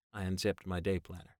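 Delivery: ordinary delivery, nothing marked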